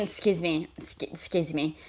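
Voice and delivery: lightly voiced Xavier, out of breath; imitating Xavier. Reacting to Xavier pushing her, a hint of breathless mimicry. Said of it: "you hear Xavier saying".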